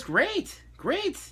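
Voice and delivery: high squeaky great great; hammed-up thrilled caller voice; mock excited client. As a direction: imitate her eager, rising delight. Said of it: "She goes".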